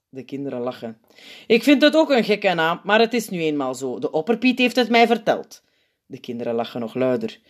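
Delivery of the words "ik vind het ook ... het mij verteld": put-on high, loud old saint voice; sweetly booming to the crowd; Sint addressing kids. A narrator's reading. Sint speaking to children.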